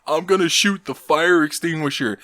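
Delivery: high, half-hearted mock scream. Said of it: "he just screams".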